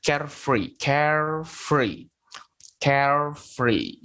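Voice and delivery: carefree said slowly, care then free. Shown as a demonstration. Teacher drilling carefree for the class, slow. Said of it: "bacanya care, terus free".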